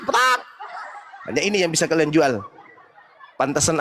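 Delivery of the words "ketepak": high-pitched mock sobbing yell; mocking crying shout. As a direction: howl like a panicked thief caught red-handed. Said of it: "hanya bisa teriak".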